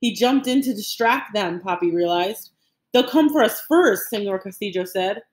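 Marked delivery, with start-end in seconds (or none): 2.9-4.1 high, urgent objection; urgent insistence. A narrator's reading. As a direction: press the point in a crisis. Urgent argument during the escape.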